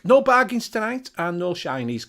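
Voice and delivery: usual speaking voice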